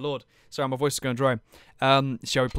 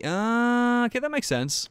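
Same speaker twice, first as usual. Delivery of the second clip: usual speaking voice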